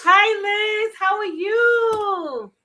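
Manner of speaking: loud. Real, not acted. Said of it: "you're a bit loud".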